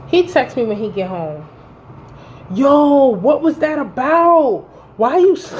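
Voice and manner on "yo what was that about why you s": high, loud, drawn-out mock outrage; imitated indignant boyfriend. Woman reads boyfriend's text after the fight, mock indignant. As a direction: exaggerate his baffled complaint, pitched up.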